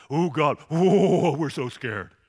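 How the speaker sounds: loud hammed-up fake fright; sarcastic mock fear. Sons-in-law mocking Lot's warning, sarcastic fake fear. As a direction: pretend to be scared, sneering.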